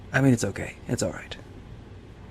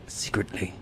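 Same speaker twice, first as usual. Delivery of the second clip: conspiratorial whisper; 5 dB quieter than usual. Pretend. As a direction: hush the word out. Whispered aside about communicating.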